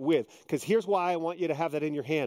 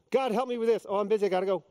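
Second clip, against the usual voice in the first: mock hurried prayer; 6 semitones higher, faster than usual. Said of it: "So we do to God".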